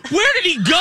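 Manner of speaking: high-pitched mock outrage; incredulous re-enacted yell at the TV; retold scream. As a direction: yell it in exasperated disbelief. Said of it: "I screamed at the T V at that moment".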